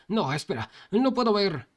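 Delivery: high, loud panicked yell; panicked shout. Acted. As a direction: cry out that you can't see. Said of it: "también le gritaba".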